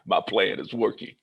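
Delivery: gleeful villain laugh into the line; sly triumphant chuckle; laughing scheming. Retold or imitated.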